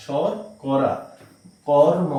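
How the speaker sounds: ordinary talk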